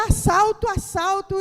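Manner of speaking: loud, high shout of assalto; robber's shout. Retold or imitated. Robber yelling at the car passengers as the holdup starts, loud high shout. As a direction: bark it out like a robber springing a holdup.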